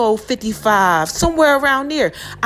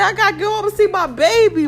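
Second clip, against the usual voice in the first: higher and near tears, a touch of crying; tearful retelling; 7 semitones higher than usual. Retold or imitated. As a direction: let the voice catch as you remember wanting your dog. Retold panic to get home.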